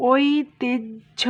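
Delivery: ঐতিহ্য spoken very slowly as "oi-ti-jjho"; slow demo. Shown as a demonstration. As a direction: draw out ঐতিহ্য, ending in a clear "jjho". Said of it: "ওইটাকে ভাঙলে হয়".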